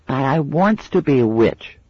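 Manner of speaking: put-on high whiny child's wail; mild childish wail. A narrator's reading. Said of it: "she wailed".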